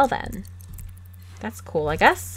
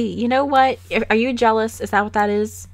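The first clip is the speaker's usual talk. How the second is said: ordinary talk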